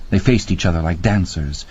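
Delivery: usual speaking voice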